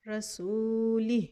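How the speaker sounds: रसूली slowly, ending on a still हा; pausal form. Shown as a demonstration. Teacher demonstrates the stop, slowly. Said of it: "वक्फ की हालत में पढ़ेंगे रसूली".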